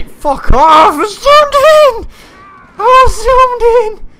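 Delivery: very high, loud screaming; enraged scream. Real, not acted. Player screaming at the game for zooming in, enraged. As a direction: scream it out high, stretched with frustration.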